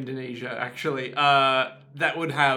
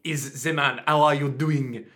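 halting attempt at English with French over it; English-French mixed accent. Co-host having a go at the actor, hesitant English-French accent. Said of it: "with that amount of French".

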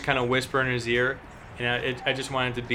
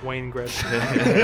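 laughing; much slower than usual. Real, not acted. Joking quote attribution.

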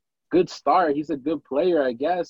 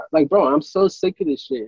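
ordinary delivery

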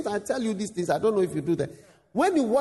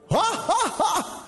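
a short, high mocking laugh; imitated laugh; 10 semitones higher than usual. Preacher imitating the professor's laughter, high and short. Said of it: "began laughing".

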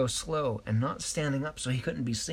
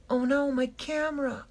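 put-on little girl high and louder, dismayed; high upset cry; 4 dB louder than usual. A narrator's reading. Little girl seeing her camera gone, high upset cry.

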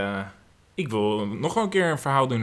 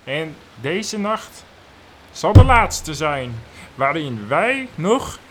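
dramatic; 4 semitones higher, 7 dB louder, slower than usual. Acted. Acting dramatic farewell scene.

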